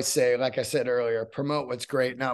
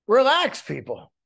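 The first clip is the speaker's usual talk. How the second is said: normal talking voice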